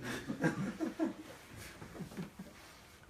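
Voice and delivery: high breathy laugh at own punchline; amused storyteller's laugh. Real, not acted. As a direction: let out a long, soft, high laugh.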